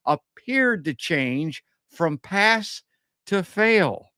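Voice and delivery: mock-dramatic contrast between "pass" and "fail"; stress pass and fail. Real, not acted. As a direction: lean hard on "pass" and punch "fail". Host stressing pass versus fail.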